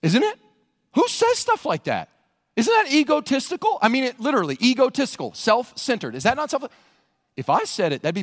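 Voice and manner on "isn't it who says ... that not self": higher and louder, incredulous; fiery preacher challenge; preaching at full force. Acted. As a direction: hammer the question at the congregation. Preacher presses the crowd on ego.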